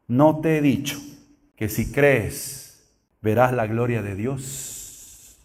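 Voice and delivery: slow, low and soft; respuesta serena. Acted. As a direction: say it gently and solemnly, spacing the words. Jesús responde solemne a Marta. (con calma solemne, lento y bajo)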